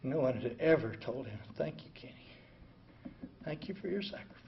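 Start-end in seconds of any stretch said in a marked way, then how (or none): 1.6-4.4 softer, half-whispered thank you. Pretend. Finally thanking Kenny for his sacrifice, softly. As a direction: say it gently, as if at last.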